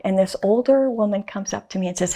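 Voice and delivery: usual speaking voice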